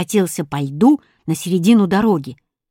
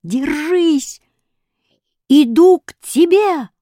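high, rallying shout; high cry, growing louder; urgent calling out; 7 semitones higher, 4 dB louder, slower than usual. A narrator's reading. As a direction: call out to someone in danger. Hero calling to endangered cat.

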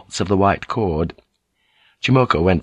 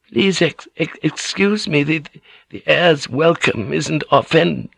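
flustered stammer; 8 semitones higher than usual. A narrator's reading. Old lady apologises to the general, flustered stammer. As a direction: trip over the words, anxious to placate.

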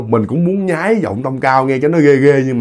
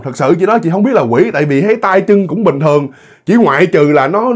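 usual speaking voice

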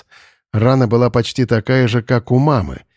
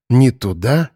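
ordinary delivery, nothing marked

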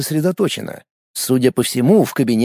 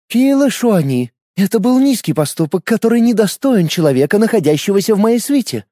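stern; 7 semitones higher than usual. A narrator's reading. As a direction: reprimand her with authority. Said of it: "строго произнесла Елена".

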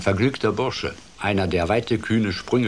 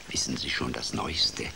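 whispered gossip; 6 dB quieter than usual. Acted. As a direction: gossip in hushed voices round the pond. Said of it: "So wisperte und raunte". (flüsternder Tratsch der Insekten)

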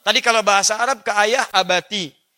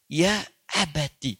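tender call; 2 semitones lower, 4 dB quieter, much slower than usual. Shown as a demonstration. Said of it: "panggilan paling indah".

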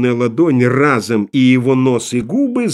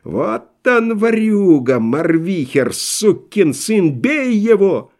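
high, drawn-out angry cry; wailing howl; 6 semitones higher, slower than usual. A narrator's reading. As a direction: howl the accusation high, stretching the words. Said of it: "кто-то взвыл в небесах". (протяжный злой вой)

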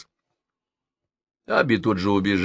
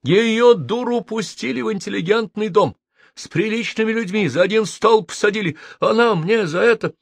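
indignant rant; 13 semitones higher than usual. A narrator's reading. Host rants tearfully about his tenant, indignant. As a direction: rail at the outrage. Host ranting after the fight.

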